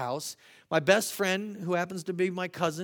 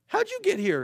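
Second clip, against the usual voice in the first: high-pitched, louder astonished question; mock bewilderment; 5 dB louder than usual. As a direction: act baffled at a child suddenly in your arms. Acted surprise at child in arms.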